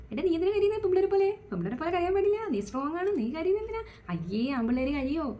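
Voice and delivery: high-pitched mock scolding of a crying boy; scolding parent. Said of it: "ഇങ്ങനെയുള്ള tag line കൊടുത്തിട്ടാണ്". (കരയുന്ന ആൺകുട്ടിയെ ശകാരിക്കുന്ന രക്ഷിതാവിന്റെ ഉയർന്ന സ്വരം)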